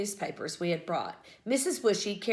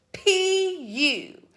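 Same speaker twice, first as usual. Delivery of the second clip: pinched-nose disgusted P U; holding her nose; 10 semitones higher than usual. A narrator's reading. Girl disgusted by smelly compost, nose pinched. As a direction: say it with your nose held shut. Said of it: "Chloe held her nose".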